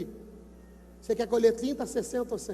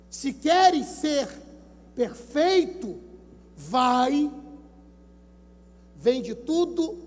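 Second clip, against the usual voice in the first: slow solemn reading; 4 dB louder, much slower than usual. Acted. Pastor reads Jesus's words solemnly.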